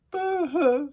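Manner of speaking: a little fairy's exaggerated boo-hoo; mock crying. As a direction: wail it like a storybook sob. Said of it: "she cried".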